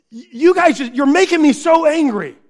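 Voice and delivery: loud, high-pitched re-enacted outburst; exasperated dad raising his voice; frustrated parent. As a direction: blow up at the kids. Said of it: "They can see that I'm frustrated".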